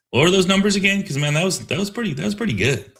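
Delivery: high, eager question; host thrilled, asking the guest to repeat his figures; excited amazement. Real, not acted. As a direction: lean in, wowed by the numbers. Amazed host asks for the numbers.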